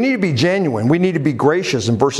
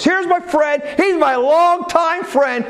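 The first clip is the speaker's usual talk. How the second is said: high, slow announcing of a friend; mock proclaiming; 13 semitones higher than usual. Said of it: "yelling out at the top of his voice".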